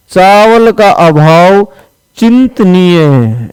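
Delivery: slow, stretched चावल का अभाव चिंतनीय है; slow dictation. Shown as a demonstration. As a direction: dictate each word slowly. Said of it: "ऐसे बड़ी की मात्रा है". (धीरे-धीरे लिखवाते हुए)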